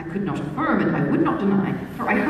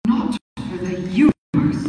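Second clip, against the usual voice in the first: a heroine's mild horrified refusal. A narrator's reading. Young lady refusing the teasing stranger, mildly horrified. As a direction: refuse him, aghast but understated.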